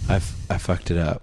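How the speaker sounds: whispered confession; a sheepish whisper. Real, not acted. Embarrassed confession.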